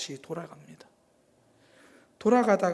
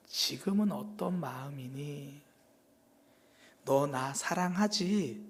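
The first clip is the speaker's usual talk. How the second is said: gentle tender question; 4 semitones lower, 4 dB quieter, slower than usual. Retold or imitated. Jesus gently asking Peter.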